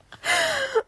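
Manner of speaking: high-pitched giggle; real, high burst of laughter. Real, not acted. Laughing at her own story, high giggle.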